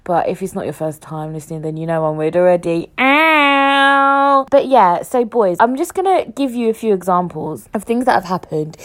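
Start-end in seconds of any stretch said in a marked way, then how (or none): 3.0-4.5 long, high, loud howl; playful howl. Pretend. Podcaster showing listeners she is weird, with a playful howl. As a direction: let out a held, silly high yowl. Whoops after calling herself weird.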